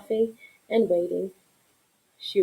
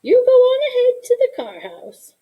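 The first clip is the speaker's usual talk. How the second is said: much higher, louder grandma voice; grandma's voice; 7 dB louder than usual. Acted. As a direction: send the child off like a grandmother. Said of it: "she said".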